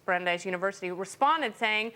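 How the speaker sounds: ordinary talk, nothing marked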